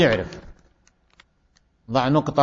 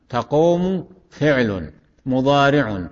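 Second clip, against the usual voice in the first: slow, word by word; slow teaching repetition; slower than usual. Shown as a demonstration. Teacher repeating the parsing for students, slow and segmented. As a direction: parse it slowly with pauses between terms. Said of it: "أعيد".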